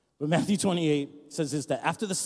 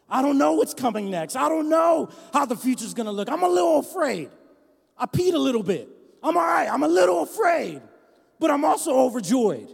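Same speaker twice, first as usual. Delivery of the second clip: high, loud impassioned preaching; rally them through fear; preaching at full force; 8 semitones higher, 6 dB louder than usual. Acted. Pastor to congregation, preaching loud and high.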